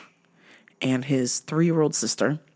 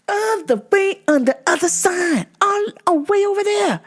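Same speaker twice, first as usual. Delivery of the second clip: much higher in disbelief; exasperated high; 13 semitones higher than usual. Real, not acted. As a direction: shriek how far away he parked. Exaggerated exasperated description.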